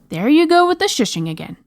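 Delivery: high-pitched, indignant princess; exasperated complaint. A narrator's reading. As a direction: complain in annoyed protest. Princess complains about shushing.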